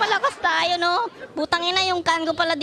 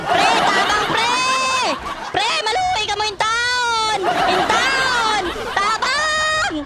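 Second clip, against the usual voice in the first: drawn-out shouts climbing higher; desperate calling out; 5 semitones higher, slower than usual. Real, not acted. Gamer begging teammates for backup, drawn-out shouting. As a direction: stretch the cry for help, rising in pitch.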